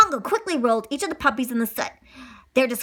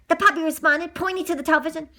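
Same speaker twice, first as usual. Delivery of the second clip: usual speaking voice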